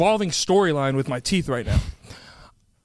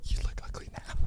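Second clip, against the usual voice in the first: whispered jab; 13 dB quieter than usual. Real, not acted. Whispering a joking insult to his friend. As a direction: hiss the insult quietly.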